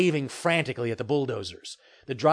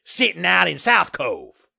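loud, higher, blustering denial; growling; 5 semitones higher, 7 dB louder, slower than usual. A narrator's reading. Developer denying any link to the cans, growling. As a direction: growl it out slowly, defensively. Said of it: "Murtaugh growled".

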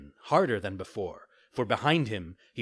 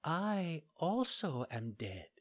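put-on high, very soft and slow ghostly girl; barely there, as if already gone; dying maiden answers the puppet, faint and slow; faint, weak voice; 6 semitones higher, 6 dB quieter, slower than usual.

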